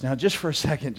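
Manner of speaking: ordinary talk, nothing marked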